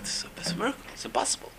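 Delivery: hushed, breathless whisper of wonder; awed whisper. Retold or imitated. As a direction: whisper it like you can't believe your eyes. Servant awed by miracle.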